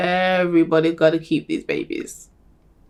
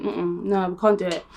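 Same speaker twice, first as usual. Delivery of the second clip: usual speaking voice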